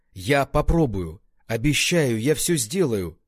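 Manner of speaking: a voice he barely recognises; надломленный голос. A narrator's reading. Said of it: "едва узнавая собственный голос". (чужой надломленный голос, громче)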